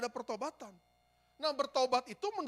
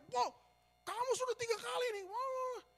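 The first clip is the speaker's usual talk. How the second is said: tiruan omelan dengan suara jauh lebih tinggi; meniru marah; 13 semitones higher than usual. Retold or imitated. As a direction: menirukan teman yang mengomel kepada petugas di meja. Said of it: "Dia marah-marah". (mengomel dengan suara tinggi, setengah bercanda)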